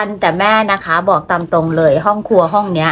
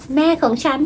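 put-on softer, higher kid's boast "แม่ของฉัน"; child bragging softly; 7 semitones higher, 4 dB quieter than usual. Mom retells sons bragging to friends, soft and high. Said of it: "มัวแต่บอกเพื่อนอยู่นั่นแหละ".

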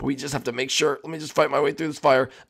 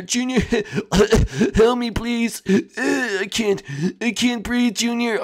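higher voice, breathless pleading; a hint of a desperate plea; 8 semitones higher, slower than usual. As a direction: Gil gasping for air. Gil trapped, no air.

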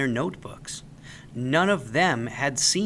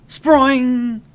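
loud, high springy sproing; springy sproing, much higher and louder; boing sound effect; 11 semitones higher, 10 dB louder than usual. A narrator's reading. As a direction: pop it like a spring. Creature leaps out.

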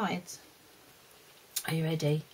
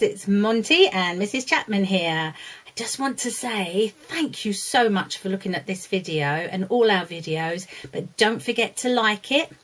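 louder, bright presenter read; outro announcement; 6 dB louder than usual. Acted. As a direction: greet parents like a channel host. Channel outro to parents.

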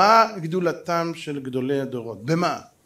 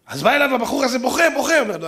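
ordinary talk

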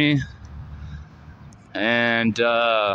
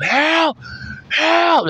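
high drawn-out yell for help; retold scream. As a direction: reenact screaming for help at the top of your lungs. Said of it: "I'm screaming for help".

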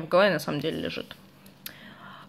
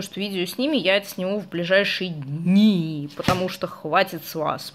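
ordinary talk

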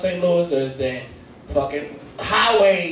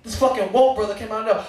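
usual speaking voice

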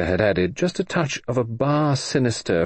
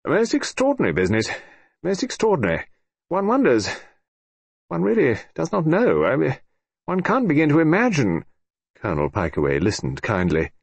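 higher, dithering fussy official; fretful Sir George; 6 semitones higher than usual. A narrator's reading. Sir George to the Colonel, fretting and dithering. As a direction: wonder aloud anxiously.

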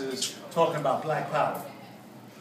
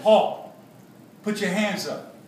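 loud, higher-pitched order to halt; police command; 5 semitones higher, 6 dB louder, slower than usual. A narrator's reading. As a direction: call out like a cop stopping a runner. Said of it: "The cops in the alley said".

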